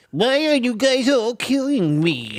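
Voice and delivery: high, loud, drawn-out silly wail; mock complaint in a high, slow put-on voice; loud goofy whining game-chat voice; favorite joke voice. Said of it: "one of my favorite go-to ones".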